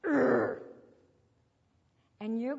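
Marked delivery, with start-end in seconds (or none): 0.0-0.6 short, loud guttural "urgh"; loud growl. Acted. Teacher voicing the little man inside, loud growl. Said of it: "he's going to go".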